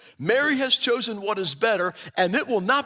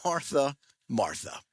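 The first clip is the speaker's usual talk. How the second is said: soft, lower, tender calling of the name; gentle; 8 semitones lower, 6 dB quieter than usual. Retold or imitated. Jesus gently calls to busy Martha, soft and tender. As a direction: say the name twice softly, with care. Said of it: "he gently says".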